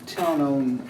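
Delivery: ordinary talk, nothing marked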